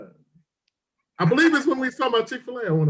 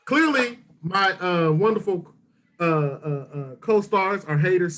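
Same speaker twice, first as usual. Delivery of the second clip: ordinary talk, nothing marked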